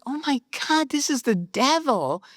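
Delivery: high-pitched, incredulous retelling; mock astonishment. Recalling the devil who taught her formatting, mock-astonished. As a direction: play up the disbelief, pitch swooping high. Acted amazement at the devil helping.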